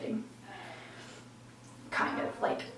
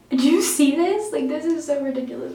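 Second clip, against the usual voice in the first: loud exasperation; 5 semitones higher, 10 dB louder than usual. Real, not acted. Vlogger fed up with her own hesitation, loud exasperation. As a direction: burst out in exasperation at yourself.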